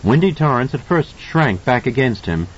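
plain reading voice

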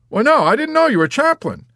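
very high, loud delight; ecstatic exclamation. A narrator's reading. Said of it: "he exclaimed ecstatically".

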